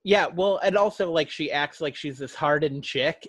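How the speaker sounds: ordinary talk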